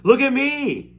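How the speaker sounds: high, loud triumphant shout. Acted. Boy shouts to everyone as he runs, high and loud. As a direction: yell it out while running. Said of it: "he shouted as he ran".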